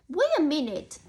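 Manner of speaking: usual speaking voice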